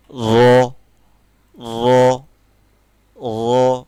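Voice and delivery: loud, full র; heavy ra. Shown as a demonstration. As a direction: say র thick and heavy. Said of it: "মোটা করে উচ্চারণটা করি".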